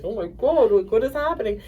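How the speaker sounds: bewildered. Retold or imitated. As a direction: mimic someone baffled by what's unfolding. Register woman reacts to tears.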